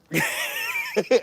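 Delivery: a jerk's laugh in an odd voice; old man laugh. Pretend. Pretending to be an old jerk laughing at someone. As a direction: cackle like a mean old man. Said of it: "laughs at you about it".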